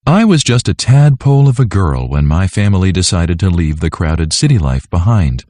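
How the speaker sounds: ordinary talk, nothing marked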